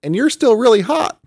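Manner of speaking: high, loud flustered squeak; embarrassed, blurt it out; loud mortified stammer; choked out. A narrator's reading. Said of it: "Samael choked out".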